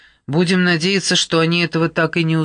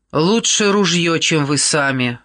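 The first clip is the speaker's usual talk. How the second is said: usual speaking voice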